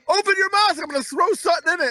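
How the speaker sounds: lightly voiced chef calling out to the table; playful mock of the showman cook; mocking a hibachi chef. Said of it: "It's a grown man going".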